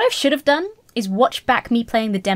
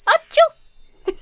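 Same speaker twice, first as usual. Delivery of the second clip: sneeze; 5 dB louder than usual. Acted. Character sneezes in flowers.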